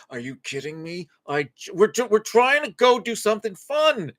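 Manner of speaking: exasperated parent voice, a bit hammed-up; mock frustrated parent. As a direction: play a fed-up mom at the door. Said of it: "You're like".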